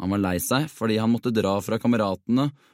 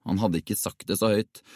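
usual speaking voice

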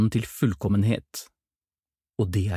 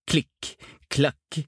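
higher, more forceful footstep sounds; approaching footsteps; 6 semitones higher than usual. A narrator's reading. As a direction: sound out the steps coming closer, pitched up. Footsteps coming nearer. (fottrinn som nærmer seg, lysere og tydeligere)

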